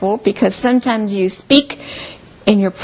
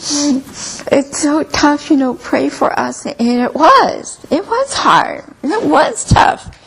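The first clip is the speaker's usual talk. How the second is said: teary, rising pitch through a laugh; retelling a hard season, choked up and higher; tearful, half laughing; 5 semitones higher, slower than usual. Retold or imitated. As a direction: voice lifting high with tearful laughter. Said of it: "I'd start to cry".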